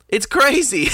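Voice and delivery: high-pitched exclamation of disbelief; incredulous. Real, not acted. Customer ranting about what Starbucks is like, incredulous.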